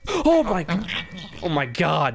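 high startled oh my; jolted, voice jumping up; sudden high-pitched shock; shocked gasp. Real, not acted. Shocked reaction to game event.

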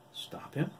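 breathless whispered question; anxious whisper to Corny. Acted. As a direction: hush it in worry. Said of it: "Edison whispered".

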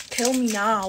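mock-dramatic "Kill me now", full voice; dramatic plea. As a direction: overplay the misery.

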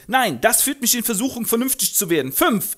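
laut, dreist fordernd; polternder Müllkutscher. Acted. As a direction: verlangt Geld für die Tochter. Doolittle lehnt ab. (laut polternder Vater)

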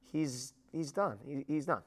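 ordinary talk, nothing marked

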